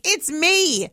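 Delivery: high, loud insistent reveal; hammed-up exasperated cry; mock exasperation. Exasperated reveal to managers, retold.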